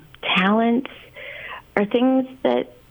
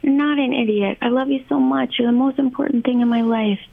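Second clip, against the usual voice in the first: imitating a parent rushing to reassure, pitched up; higher-voiced, over-reassuring mock parent; doting parent; 6 semitones higher than usual. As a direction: gush over a child in protest, voice held high. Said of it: "they're quick to say".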